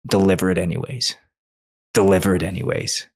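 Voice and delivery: a low steady whisper of resolve to himself; inner voice. Retold or imitated. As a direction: murmur it like a voice inside your head. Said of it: "something flipped inside of me and goes".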